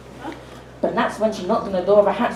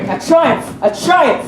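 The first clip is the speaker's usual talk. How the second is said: higher, louder triumphant cry; exultant; 6 semitones higher, 5 dB louder, much slower than usual. Acted. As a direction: crow over success. Triumph after the scheme worked.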